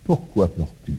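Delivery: soft, low, caring question; tender question. Retold or imitated. Risen Jesus asking Marie, tender and hushed. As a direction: ask gently, as if leaning close. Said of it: "il lui pose une question".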